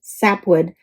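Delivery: usual speaking voice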